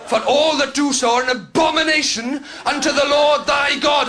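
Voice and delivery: booming mock preacher, slow and loud; hammed-up hellfire sermon; fire and brimstone preaching. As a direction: thunder it from the pulpit. Mock preacher quoting scripture.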